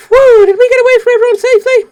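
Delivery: put-on very high, loud relieved girl; a breathless squeaky sigh of relief; high squeaky anime girl. Escaping everyone, very high squeaky girl voice.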